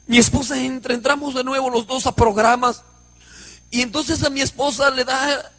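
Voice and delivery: ordinary talk, nothing marked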